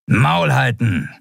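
high, drawn-out snarl of a guard; snapped order. A narrator's reading. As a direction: snap at the prisoners, stretching the words. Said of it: "schnauzte sie ein Skria-Weißmantel an". (gedehnt und hoch angeschnauzt)

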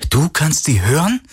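Galen, startled and defensive; young hero voice. A narrator's reading. (leicht höhere Stimme eines jungen Helden)

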